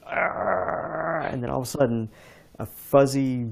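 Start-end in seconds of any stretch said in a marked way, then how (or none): 0.0-1.3 high strained frustrated argh; short angry cry of effort; mock angry growl. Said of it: "I was like".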